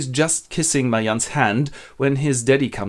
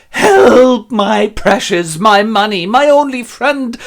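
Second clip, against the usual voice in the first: drawn-out, high hysterical wail; screaming blue murder; 11 semitones higher, 9 dB louder, slower than usual. Acted. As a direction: shriek desperately for your lost treasure. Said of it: "screams blue murder".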